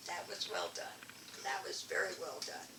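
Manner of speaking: soft, half-whispered praise; quiet praise. Retold or imitated. Shirley Chisholm praising her speech, soft murmur. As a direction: murmur it warmly under your breath.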